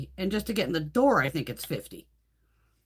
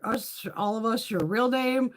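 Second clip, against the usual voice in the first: ordinary talk, nothing marked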